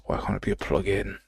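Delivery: usual speaking voice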